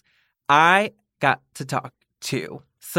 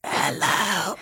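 spooky voice; 4 semitones higher, 4 dB quieter than usual. Pretend. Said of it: "Should we all take a turn".

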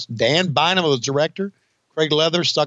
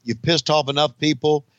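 normal talking voice

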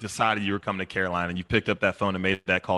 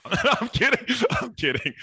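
laughing it off, pitched high. Real, not acted. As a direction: break into a laugh as you take it back. Owning up to a joke.

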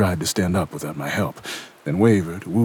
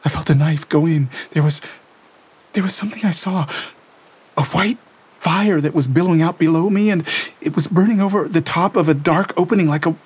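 put-on high, tense young woman's voice reliving horror; Lena shaken; 12 semitones higher, 6 dB louder than usual. A narrator's reading. Lena telling Gabriel what she felt, shaken and tense. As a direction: voice Lena trembling through the vision. Lena recounts the stabbing vision.

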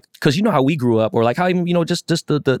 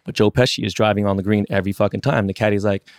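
normal talking voice

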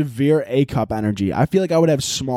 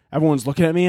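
normal talking voice

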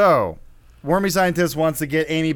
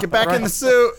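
higher, mock crew order; film crew ordering; 8 semitones higher, 4 dB louder than usual. Film crew to suited actress, mock ordering. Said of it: "And they're like".

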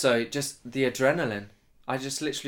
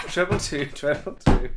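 laughing, higher and louder; 7 semitones higher, 5 dB louder, slower than usual. Real, not acted. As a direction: giggle out the words. Laughing, playful call.

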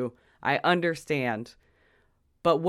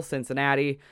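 normal talking voice

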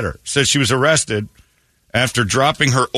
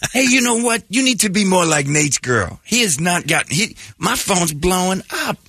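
put-on nagging girlfriend; 9 semitones higher than usual. Girlfriend scolding.

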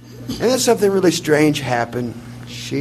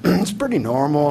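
ordinary talk

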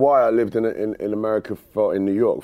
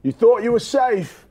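higher, slow dramatic promo read; sports promo voice; 9 semitones higher, 3 dB louder, slower than usual. Shown as a demonstration. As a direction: boom it out like a hype trailer. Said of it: "can I just do it in my own accent".

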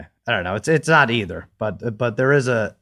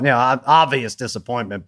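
ordinary talk, nothing marked

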